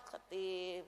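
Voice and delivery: usual speaking voice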